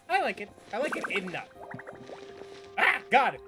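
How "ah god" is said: normal talking voice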